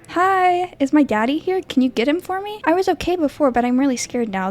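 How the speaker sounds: put-on high, sweet little girl asking for daddy; timid child's voice, pitched high. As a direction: play a scared small child at the door.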